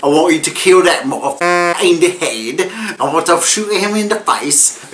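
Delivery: high, menacing mob boss; gangster boss voice. Retold or imitated. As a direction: bark the order like a crime boss. Voicing Harry's angry order.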